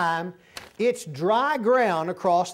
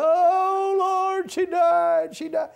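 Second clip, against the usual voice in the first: high, loud sing-song imitated lament; half-sung mock wail over the dead sister; mock moaning cry; 11 semitones higher, 6 dB louder, much slower than usual. Preacher imitating the grieving sister crying in her room, high and wailing. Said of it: "you hear her moaning and crying".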